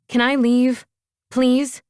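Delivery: high, loud exasperated asking; impatient plea. A narrator's reading. Asks impatiently for permission to go.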